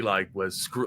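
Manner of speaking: ordinary delivery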